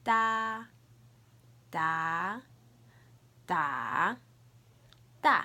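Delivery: sing-out "Da" through the four Mandarin tones, drawn out, last one short; four tones demo. Shown as a demonstration. Teacher modelling for learners to repeat, four loud tones on "Da". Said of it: "we're gonna do the four tones".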